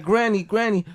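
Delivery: high, loud calling out. Retold or imitated. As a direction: call for granny, loud and high. Kids calling for grandma.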